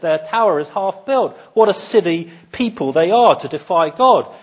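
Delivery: high, loud scornful ridicule; mocking derision. Israelites hearing the story of Babel, loudly mocking. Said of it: "The Israelites, when this story was being told, had been laughing".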